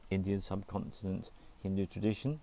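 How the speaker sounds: normal talking voice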